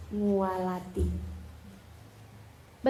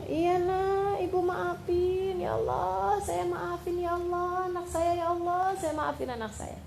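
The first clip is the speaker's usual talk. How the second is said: acted tearful forgiveness; 7 semitones higher than usual. Mother forgiving her child on the phone, acted tearful. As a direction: play a mother wailing as she forgives.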